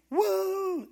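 very high whooping cheer; crowd whoop. Retold or imitated. People seeing fire from heaven, high excited whoop. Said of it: "the people, like".

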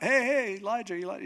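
high, loud, eager hail; calling after someone. Acted. Elisha comes hustling out after Elijah, calling out. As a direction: call out as if hurrying to catch up.

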